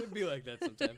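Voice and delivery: laughing through a meme quote. Real, not acted. Friend cracking up, quoting the meme to the group, laughing. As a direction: giggle the words out, fast.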